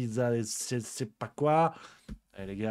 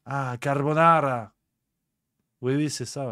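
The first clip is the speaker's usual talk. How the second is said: mock Italian waiter. A mock Italian waiter corrects the customer's carbonara. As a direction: put on a smug Italian waiter accent, lightly.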